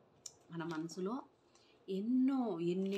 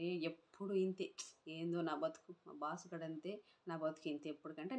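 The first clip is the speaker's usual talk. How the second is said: softer, muttered complaint under the breath; grumbling to himself; 4 semitones lower, 6 dB quieter than usual. Acted. Late employee dreading his boss, softly muttering complaints.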